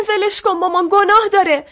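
put-on high, tearful begging of a small girl; pleading. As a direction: plead with mother through tears. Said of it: "التماس کردم".

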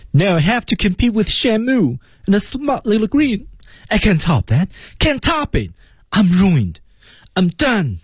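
high-pitched despairing rant; desperate meltdown. Acted. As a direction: rant it high, as if all is lost. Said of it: "就是非常的绝望啊". (高声绝望地一口气控诉)

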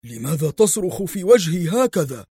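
patient angrily protesting to the doctor; angry. A narrator's reading. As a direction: push back at being yelled at. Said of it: "ثم هتف في غضب".

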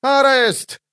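very high acted shriek; piercing high cry. A narrator's reading. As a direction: shriek it out at the top of the voice range. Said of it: "визжала женщина".

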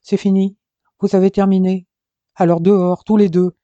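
loud, angry order out; thundering. Acted. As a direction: throw them out, booming. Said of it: "tonna Jason".

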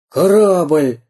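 громкий радостный выкрик; восклицает, увидев корабль; восторженный возглас пирата. Acted. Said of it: "воскликнул пират". (пират в восторге восклицает: «Корабль!»)

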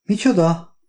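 high-pitched incredulous question; baffled. A narrator's reading. Wife reacts to Oliver's sudden order, baffled.

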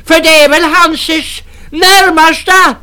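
put-on very high loud shrieking old woman; furious crone. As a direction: drive them out with a screech. Crone orders men out.

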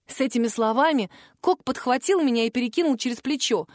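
ordinary delivery, nothing marked

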